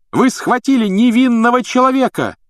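high-pitched, loud protest; shouting. A narrator's reading. Harry accusing the minister of a wrong arrest, shouting. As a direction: yell in outrage at them. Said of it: "закричал Гарри".

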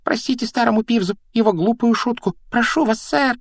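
high-pitched squeaky poltergeist begging pardon; Peeves pleading. Acted.

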